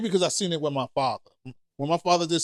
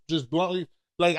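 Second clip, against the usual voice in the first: usual speaking voice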